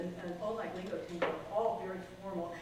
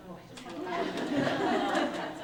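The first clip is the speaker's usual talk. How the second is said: a fan's gush that swells to a loud cry of love; 7 semitones higher, 10 dB louder than usual. Real, not acted. Poet gushing to the audience about [name], swelling excitedly. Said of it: "but I love [name] more".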